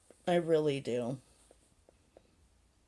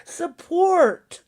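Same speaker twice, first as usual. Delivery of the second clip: higher, louder mocking outburst; mock exclamation; 8 semitones higher, 6 dB louder than usual. Streamer ridiculing chat lingo, mocking. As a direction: blurt it out high and loud in ridicule. Mocking a word she finds weird.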